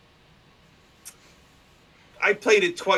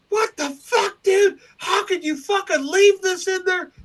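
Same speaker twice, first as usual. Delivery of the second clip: much higher angry yell into the phone; furious yelling; 4 dB louder than usual. Retold or imitated. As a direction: blast the producer who left it in. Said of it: "I was mad as fuck".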